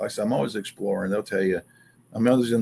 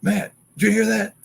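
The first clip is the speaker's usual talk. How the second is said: high, urgent whisper-free alarm; urgent alarmed question; 9 semitones higher than usual. Retold or imitated. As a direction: voice pitched way up in alarm. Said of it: "that's when I was like".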